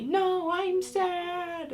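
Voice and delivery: high, long-drawn wail imitating a daughter; child's mock yell. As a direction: stretch it out like a protesting little kid. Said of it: "sometimes yell at me".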